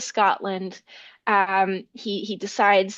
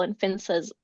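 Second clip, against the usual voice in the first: plain reading voice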